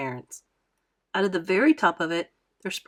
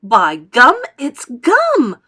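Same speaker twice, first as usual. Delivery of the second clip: high, loud shriek of glee; 4 semitones higher, 12 dB louder, much slower than usual. A narrator's reading. Gum-mad girl spotting gum, shrieking with glee. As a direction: squeal it like a kid grabbing candy. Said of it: "she shrieked".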